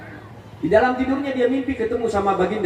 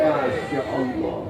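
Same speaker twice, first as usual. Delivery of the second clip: hushed awe; 8 semitones lower, 4 dB quieter, much slower than usual. Real, not acted. Awed soft exclamation.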